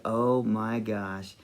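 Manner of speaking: slow, soft, low awe; awestruck. Retold or imitated. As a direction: breathe out each word, still stunned. Remembered stunned reaction to kiss.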